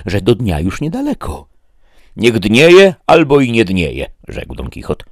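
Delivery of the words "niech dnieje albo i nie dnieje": louder, higher proud declaration; defiant knight. Acted. Don Quixote brushes off Sancho's fears, loud and proud. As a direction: cast off the dawn like a proud knight.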